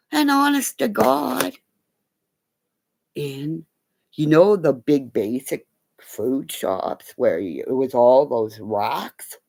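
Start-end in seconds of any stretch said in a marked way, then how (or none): none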